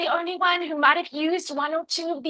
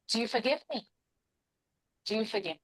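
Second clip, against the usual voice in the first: softer, sincere asking for forgiveness; gentle plea; 2 semitones lower, 9 dB quieter, faster than usual. Shown as a demonstration. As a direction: ask softly and humbly. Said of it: "I'm thinking ahead to, like, Tishrei here".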